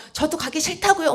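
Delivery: high-pitched mock protest; imitated whiny refusal; defiant retort. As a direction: push back like a balking child. Imagined defiant retort to scolding.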